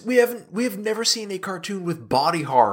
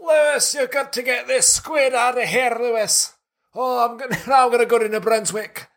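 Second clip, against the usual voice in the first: hammed-up Canadian accent, higher, pleading with Lewis; 5 semitones higher than usual. Redoing the cartoon's Lewis line in an overdone Canadian accent. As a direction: overdo a Canadian kid begging, then a quick laughing aside. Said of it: "You do a better Canadian".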